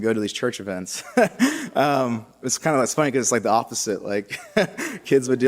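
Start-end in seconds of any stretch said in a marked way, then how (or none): 1.1-1.7 brief, high-pitched amused laugh; short chuckle. Real, not acted. Amused at his own irony. 4.5-5.0 small, high amused giggle; quick chuckle. Real, not acted. Amused at the reversed excuse.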